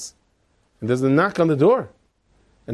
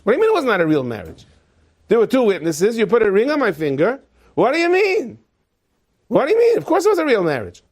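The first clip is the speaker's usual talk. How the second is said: rising, high-pitched outrage; indignant protest; 7 semitones higher, 4 dB louder than usual. Acted. Wife told the marriage was not real, indignant. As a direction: argue back, climbing higher with each question.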